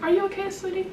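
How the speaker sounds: sweet, hushed concern; tender concern. Retold or imitated. Mother checks on quiet child.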